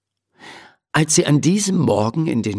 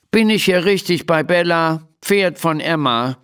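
higher-pitched grumpy stranger; 6 semitones higher than usual. A narrator's reading. Unseen visitor asking at Emma's stable door, grumpy. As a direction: ask it with peevish impatience. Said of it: "fragt jemand in verdrießlichem Ton".

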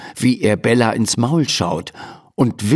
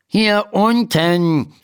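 slow, higher, mildly annoyed; a touch of irritation from below; 9 semitones higher, much slower than usual. A narrator's reading. Tiny doctor tells Emma to look down, mildly irritated. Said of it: "sagt die Stimme verärgert".

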